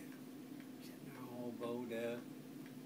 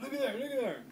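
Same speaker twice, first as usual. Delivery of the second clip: high, loud urgent pointing; excited call; 14 semitones higher, 7 dB louder, faster than usual. Retold or imitated. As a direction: call it out like you just spotted something. Uncle spotting animal again.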